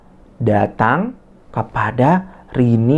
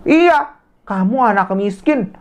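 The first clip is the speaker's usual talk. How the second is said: taunting bully; 9 semitones higher than usual. Acted. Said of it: "Lalu Rini bilang".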